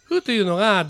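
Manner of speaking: normal talking voice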